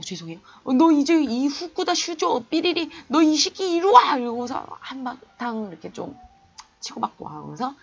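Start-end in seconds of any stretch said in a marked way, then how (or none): 0.7-4.2 higher, louder, slow angry shout; furious yell. Retold or imitated. Cousin shouts at Fukuda for harassing girls, furious yell. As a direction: yell at him like an outraged cousin.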